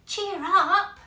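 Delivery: big loud cheer up; hearty cheer. Acted. Giant cheers up the mouse family, loud and hearty. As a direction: boom it out kindly.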